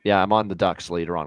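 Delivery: ordinary talk, nothing marked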